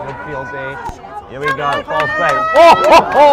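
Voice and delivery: loud high commentator yell; excited shout. Real, not acted. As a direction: erupt at the big moment. Commentator erupts at big play.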